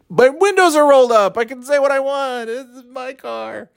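high-pitched indignant protest; defensive. Acted. Defending trash talk in his own car, high indignant.

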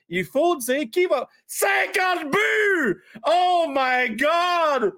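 high, very slow drawn-out shout; mock shout. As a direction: bellow the big bid like a wild auction. Shouted fake bid. (cri exagéré, très lent et aigu)

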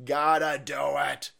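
GOTTA DO IT stretched slowly; drawn-out mock resolve. Host repeating the plotters' line, slow drawn-out voice. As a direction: drag out each word of the plotters' line.

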